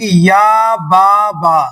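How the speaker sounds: slow mock misreading "یا با با"; kids' misreading. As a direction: drag out "یا با با" the way kids wrongly read it. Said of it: "بچے کیا پڑھنے لگتے ہیں".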